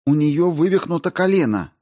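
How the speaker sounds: high, brisk woman astrobiologist; Рао объясняет. Acted. Narrator voices Rao explaining. (высоким голосом, деловито и встревоженно)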